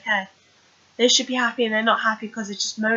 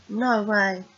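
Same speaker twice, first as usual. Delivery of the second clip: usual speaking voice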